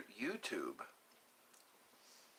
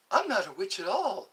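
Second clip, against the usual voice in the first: higher, lilting mock-innocent voice; acted film line; 6 semitones higher, faster than usual. Answering the witch question from a film, high put-on voice. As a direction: pitch it up like a storybook character.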